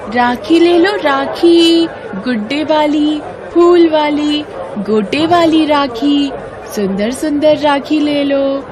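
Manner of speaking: high, loud street-vendor call, slowing at the end. Acted. Girl loudly calling buyers to her rakhi cart. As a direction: hawk rakhis across the market. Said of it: "जोर से चिल्लाती है".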